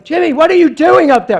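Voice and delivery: high, loud yell up the stairs; yelling mother. Retold or imitated. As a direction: imitate a mom hollering to her kid upstairs. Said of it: "she's yelling".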